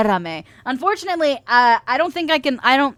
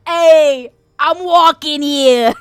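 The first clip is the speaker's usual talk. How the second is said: loud, high Brooklyn holler, drawn out; Brooklyn accent; 5 semitones higher, 6 dB louder, much slower than usual. Pretend. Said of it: "Brooklyn May".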